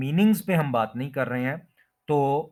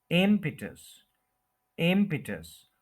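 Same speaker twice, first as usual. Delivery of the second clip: Impetus as IM-pi-tus; impetus falling away from a stretched IM; stress on first syllable; 3 semitones higher, slower than usual. Shown as a demonstration. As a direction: lean on IM in impetus, short pi. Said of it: "IM पे stress है".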